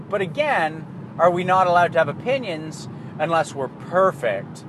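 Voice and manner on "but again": ordinary talk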